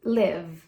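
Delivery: Live said as lev with a voiced v; model pronunciation. Shown as a demonstration. Teacher modelling the verb for students. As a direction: clip Live short, buzzing the v. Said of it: "o verbo to live".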